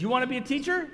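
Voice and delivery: high, loud retold question; loud challenge. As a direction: throw the question out loudly, imitating the professor. Professor's challenge in retold exchange.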